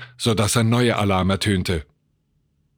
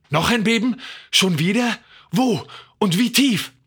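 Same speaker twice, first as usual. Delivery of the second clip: high-pitched, alarmed questions; 11 semitones higher than usual. A narrator's reading. Wife urgently asking about a new earthquake, alarmed. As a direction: fire off startled questions in a raised voice. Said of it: "wollte seine Frau alarmiert wissen".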